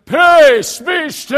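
huge, high shout of peace be still; thundering showy command; dramatic yell. Shown as a demonstration. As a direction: bellow it for the whole world to hear. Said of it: "I don't think he went".

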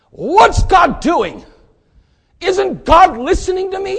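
high, loud exasperated plea; frustrated complaint. Retold or imitated. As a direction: voice the impatient believer crying out to God. Preacher voicing frustrated believer.

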